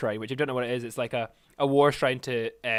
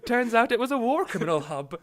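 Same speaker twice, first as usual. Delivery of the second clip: [name]; 8 semitones higher than usual. Acted. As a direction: play him as a clueless high-voiced kid. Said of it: "it's just the image of him, like".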